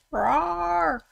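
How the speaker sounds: high, drawn-out mock roar; imitated roar. Shown as a demonstration. Streamer showing what they basically do, playful roar. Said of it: "what I do is go".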